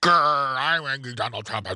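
mock growl of anger; mock angry Trump. Host mimicking Trump storming out of court, mock angry. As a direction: growl it in pretend anger, half-hearted. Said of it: "he got angry and he stormed out".

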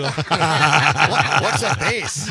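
loud, laughing echo of the question; real laughter bursting through the words; laughing repeat. Real, not acted. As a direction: crack up while repeating it. Repeats guest's line, cracking up.

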